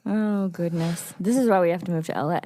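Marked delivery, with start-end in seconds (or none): none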